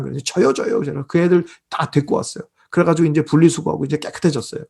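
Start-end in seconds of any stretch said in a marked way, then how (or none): 0.2-0.8 higher-pitched mock volunteers; imitated eager clamour. Young fans volunteering to help the star, imitated eager clamour. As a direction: pipe up like kids begging to be picked. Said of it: "난리를 친 거예요".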